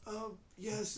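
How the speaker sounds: put-on high, halting frail old man. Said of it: "this kind of frail voice".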